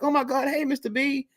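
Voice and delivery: mock the girl's sudden friendliness; imitating a teen girl. Girl now greets him happily.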